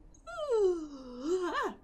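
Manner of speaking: big drawn-out yawn; yawning sigh. Real, not acted. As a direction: yawn it out loud. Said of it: "Just the mention of it makes me yawn".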